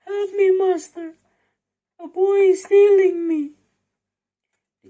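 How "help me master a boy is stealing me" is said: very high, very loud cry for help; harp crying for help. Acted. The magical harp calls its master as Jack steals it, high loud cry. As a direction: scream for the master in alarm. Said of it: "the magical harp cried".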